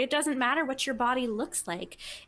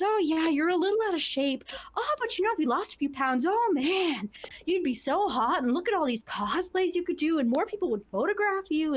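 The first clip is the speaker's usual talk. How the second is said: higher, swooping mock-helpful tone; imitating a patronizing commenter, pitched up; mock body-shamer; 5 semitones higher than usual. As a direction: gush the backhanded advice mockingly. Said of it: "make some really nasty, like, just uncalled for comments".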